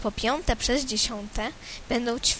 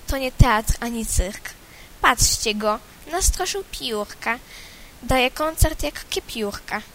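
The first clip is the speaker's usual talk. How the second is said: ordinary delivery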